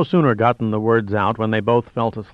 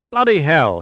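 higher, louder bitter outburst; bitter snap; 6 semitones higher, 4 dB louder than usual. A narrator's reading. Plunkett hears they are held two months, snapping bitterly. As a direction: spit it out in bitter anger. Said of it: "he snapped bitterly".

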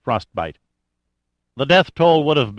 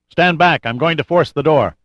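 raised, loud warning through a door; 5 semitones higher, 5 dB louder than usual. A narrator's reading. Rescuer shouting through a locked door to trapped women, loud and firm. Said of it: "He raised his voice".

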